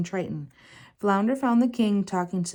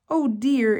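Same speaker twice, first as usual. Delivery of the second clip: higher and louder, startled dismay; dismayed cry; 5 semitones higher, 5 dB louder than usual. A narrator's reading. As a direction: gasp it out, caught by surprise. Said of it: "Ariel cried".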